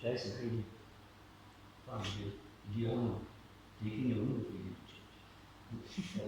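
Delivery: very soft, close-up murmur; hushed, private little chat; demonstrated whisper. Shown as a demonstration. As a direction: speak low right into someone's ear. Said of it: "I have to just whisper to him".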